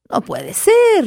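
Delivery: high shocked disbelief. A narrator's reading. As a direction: gasp it out in shock. Maker shocked.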